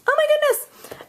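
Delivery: very high, loud gushing squeal; excited squealing. Real, not acted. Crafter squealing to viewers over her butterfly card. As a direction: squeal with delight at something adorable.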